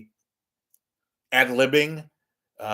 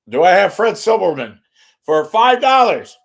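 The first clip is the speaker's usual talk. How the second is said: loud, high-pitched shouted offer; big raised voice, playing to the room; mock announcer call; 7 semitones higher, 11 dB louder than usual. Mock auctioneer shouting out a name.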